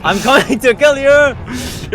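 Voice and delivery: high, loud, slow menacing put-on voice; mock villain threat. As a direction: drag out the threat like a cartoon villain.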